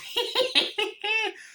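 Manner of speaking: very high staccato cackle; mock evil giggle. Chucky after the face burns, high mock cackle. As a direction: imitate a gleeful killer doll's laugh.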